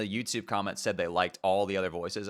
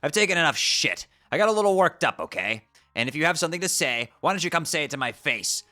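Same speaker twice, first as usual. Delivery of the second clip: higher, louder, fed-up ranting troll; angry troll boy; 5 semitones higher, 6 dB louder than usual. Acted. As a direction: snap back at her, building to a rant. Voices Karkat in game dialogue.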